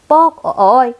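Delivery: sharp ouch after a knock on the head; cartoon ouch from a bonk; bonk and yelp of pain. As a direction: yelp as if just smacked. Hit on head, cries out.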